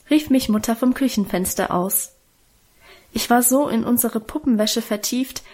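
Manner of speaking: ordinary delivery, nothing marked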